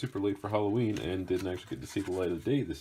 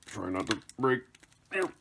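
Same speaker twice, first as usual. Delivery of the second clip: straining, strained words then grunts; effort grunt; 9 semitones higher, 5 dB louder than usual. Real, not acted. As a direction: push through it like lifting something heavy. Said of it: "copious amounts of grunting".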